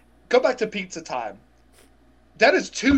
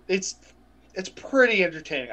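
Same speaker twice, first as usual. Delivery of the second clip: ordinary delivery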